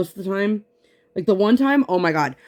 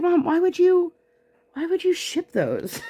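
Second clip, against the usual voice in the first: incredulous; 11 semitones higher than usual. Retold or imitated. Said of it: "I was like".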